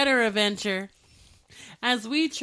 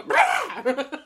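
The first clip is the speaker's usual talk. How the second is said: mock dog bark; 3 semitones higher, 7 dB louder than usual. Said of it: "I wanna hear him go".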